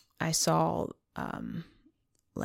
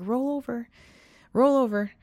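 high, coaxing call to a dog; sing-song command to a lazy dog; coaxing dog owner; 7 semitones higher, 3 dB louder than usual. Retold or imitated. Lady calling her dog to roll over, coaxing. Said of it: "she goes up to the dog and she's like".

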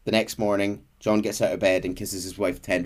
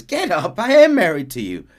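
high, loud retold protest; playful mock scolding; 8 semitones higher, 7 dB louder than usual. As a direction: scold him affectionately, voice jumping up. Wife hits his shoulder, scolds him.

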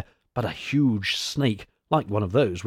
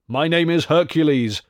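raised, higher-pitched storybook declaration; mild roar; 5 semitones higher, 4 dB louder than usual. A narrator's reading. Mighty stranger to the Old One, mildly roaring. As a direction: proclaim it with a touch of a hero's roar. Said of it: "roared the mighty stranger".

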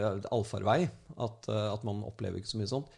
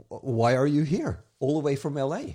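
pointed question; 5 semitones higher, 9 dB louder than usual. Retold or imitated. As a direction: press her on why she came. Retold challenge to the agent.

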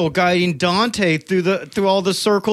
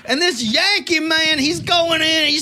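higher hammed-up Southern country voice; mock country accent; 6 semitones higher than usual. Mocking Dalton as a country boy, a higher mock Southern accent. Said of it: "simple old country boy".

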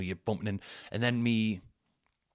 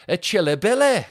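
loud, high mock Mancunian; Mancunian accent; 10 semitones higher, 10 dB louder than usual. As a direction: belt out a caricature Manchester accent. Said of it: "that Mancunian accent is bang on".

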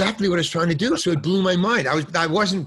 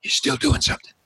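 breathy whispered aside, no voice; 12 semitones higher, faster than usual. Retold or imitated. Director passing by whispers a note to the actor. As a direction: whisper it right into his ear. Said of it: "he just whispered in my ear".

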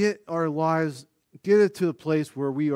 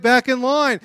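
loud, high marching order; mock command to the group; imitated caller's shout; 9 semitones higher, 8 dB louder than usual. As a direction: call campers back like a drill leader. Acting caller ordering campers back.

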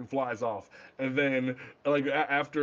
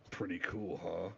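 a hint of a deep, hushed Batman; lightly voiced gruff Bruce, softer and lower; mock low Batman line; 10 semitones lower, 11 dB quieter than usual. Bruce's line quoted mockingly.

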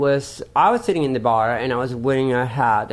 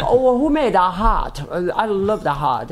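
a hint of a British accent, higher; imitating a Brit; 3 semitones higher than usual. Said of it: "no, no, he was like".

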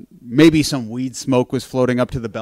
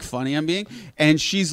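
ordinary delivery

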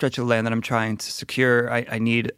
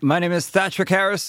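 a hint of a proud knight; knightly persona; 7 semitones higher than usual. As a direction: announce yourself like a larping knight. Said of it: "when I hold my helmet and my shield".

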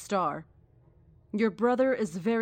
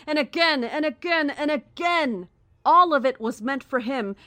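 louder and higher with each again; building accusation; 5 semitones higher, 6 dB louder than usual. Acted. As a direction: hammer it home, rising in anger. Naya accuses Elliot, building intensity.

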